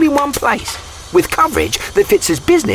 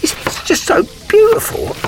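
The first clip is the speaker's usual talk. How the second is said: emotional; 10 semitones higher than usual. Acted. Geico rep to Janice, mock teary and overcome. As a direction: voice cracking high, on the verge of tears. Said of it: "I just get so emotional".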